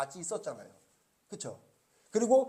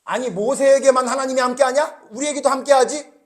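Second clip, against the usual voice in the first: higher, louder resentful challenge; indignant protest; 7 semitones higher, 12 dB louder than usual. Retold or imitated. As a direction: demand it like a jealous sibling. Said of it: "미리암과 아론이".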